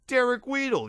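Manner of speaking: high excited call of the name; yelled. A narrator's reading. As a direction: shout it, thrilled to recognise him. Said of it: "yelled another".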